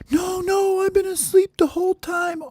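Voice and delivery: very high falsetto protest; squeaky denial. Acted. Baby bird tells friends he slept through it, squeaky denial. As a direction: squeal your innocence.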